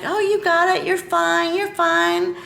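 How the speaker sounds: imitating upbeat trainer. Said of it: "my trainer's like".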